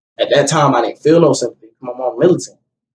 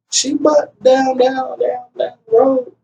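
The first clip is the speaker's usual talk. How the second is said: put-on high, slow little-boy voice, lightly done; young son; 9 semitones higher, slower than usual. Father voicing his small son giving directions, lightly childlike. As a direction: imitate the child spelling it out slowly. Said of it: "he was like".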